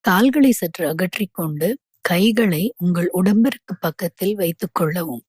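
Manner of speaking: ordinary talk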